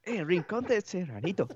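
high, slow mock Japanese tourist voice. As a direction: deliver it as the deadpan tourist punchline. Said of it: "me dice".

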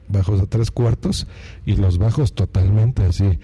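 deep booming bass-heavy voice; low rumbling voice with heavy bass. Shown as a demonstration.

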